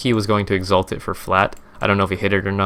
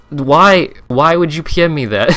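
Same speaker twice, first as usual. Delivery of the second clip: high, louder, incredulous question; exasperated disbelief; 7 semitones higher, 4 dB louder than usual. Real, not acted. Streamer reacting to a pointless private message, exasperated. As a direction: drag out the why, then protest in disbelief.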